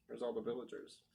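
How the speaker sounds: plain reading voice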